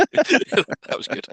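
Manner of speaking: high drawn-out laughing words. Real, not acted. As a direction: laugh it out slowly. Laughing at the scene.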